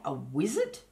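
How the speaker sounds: plain reading voice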